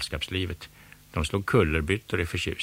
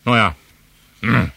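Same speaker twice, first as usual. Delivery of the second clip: louder, grumbling concession; irritated grudging yield; grudging angry; 4 semitones higher, 9 dB louder than usual. A narrator's reading. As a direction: give in against your will. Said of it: "sa Måns ilsket".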